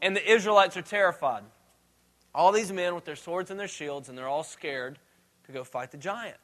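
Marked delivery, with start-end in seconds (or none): 2.3-6.4 much softer, lower, like sharing a scared secret; hushed and fearful. A narrator's reading. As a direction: drop the voice to show their fear. Narrating terrified Israelites.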